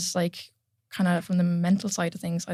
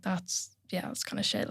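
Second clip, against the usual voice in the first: whispered, half to herself; whispered aside; 10 dB quieter, slower than usual. Real, not acted. Lifter whispering to the host about her missed lifts. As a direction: whisper it like a rueful confession.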